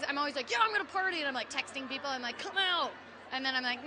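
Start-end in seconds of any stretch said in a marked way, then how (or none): 0.5-1.2 high, eager whoop of a line; mock party excitement. Self-mocking partygoer texting friends, mock excitement. 2.4-2.9 high, playful call; mock eager urging. Self-mocking partygoer texting friends, mock eagerness.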